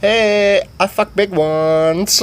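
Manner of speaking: high, slow, drawn-out boast; half-drunk character. Retold or imitated. Voices General Sweet Pants.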